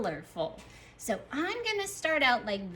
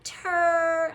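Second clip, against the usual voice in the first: TUR held long, higher and louder; sounding out slowly; 4 semitones higher, 6 dB louder than usual. Shown as a demonstration. Teacher sounds out the word for writing, slowly stretched. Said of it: "I'm gonna say it slow".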